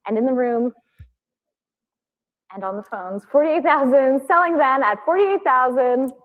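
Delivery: brisk auction calling, closing the lot; fine art auctioneer. Real, not acted. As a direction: call the last bids like an auctioneer.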